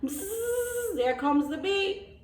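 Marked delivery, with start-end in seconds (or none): 0.0-1.0 high buzzing bee noise. Acted. Bee flying by, a soft high buzz.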